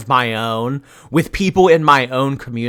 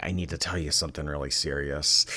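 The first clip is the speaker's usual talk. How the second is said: low, quick, hushed fake confession; mock serious confession; 7 semitones lower, 8 dB quieter, faster than usual. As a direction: imitate a grave confession, dropping the voice. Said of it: "teasing each other by saying".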